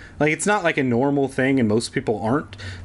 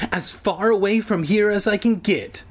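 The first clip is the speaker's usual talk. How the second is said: put-on wistful boy voice; 8 semitones higher than usual. Boy voiced in read story.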